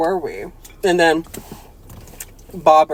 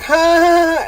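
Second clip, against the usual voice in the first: high, loud fake laugh; mock laugh; 11 semitones higher, 6 dB louder than usual. Mocking the priest with a hammy laugh. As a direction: ham up a villain's laugh. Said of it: "And then he's like".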